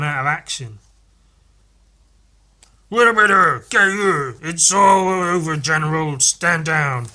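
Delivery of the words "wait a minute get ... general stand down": loud, hammed-up order to stand down; acted urgent shout. Reading a character halting the General, urgent shout. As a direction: shout it like a comic-book standoff. Voicing shouted comic-book dialogue.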